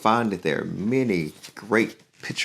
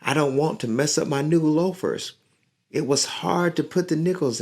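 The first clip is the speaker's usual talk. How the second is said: put-on higher, pleading boy; begging; 10 semitones higher, 4 dB louder than usual. Boy begging the bully to spare his loafers, mildly high. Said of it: "Rico begged".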